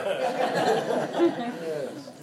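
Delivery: high, airy laughter trailing off. Real, not acted. Preacher laughing with the congregation about grandma, high laugh. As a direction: laugh freely at the spoiled-grandchild joke.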